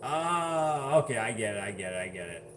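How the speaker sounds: normal talking voice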